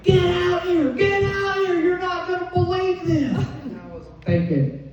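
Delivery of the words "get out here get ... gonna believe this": high excited yell to come outside; urgent husband shouting for her; husband calling out. Retold or imitated. Husband calling wife outside, high and excited. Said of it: "And he's like".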